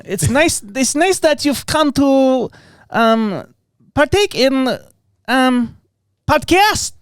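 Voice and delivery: halting attempt at a Finnish accent; a hammy Finnish accent, Borat-ish. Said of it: "I'm trying to work on my Finnish accent".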